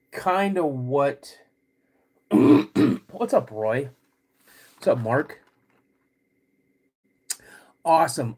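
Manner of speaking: ordinary delivery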